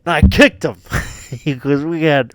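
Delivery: ordinary talk, nothing marked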